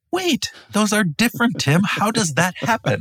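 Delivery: high-pitched, hammed-up disbelief; playfully exaggerated shock; mock astonishment. Mock astonishment at co-host's list.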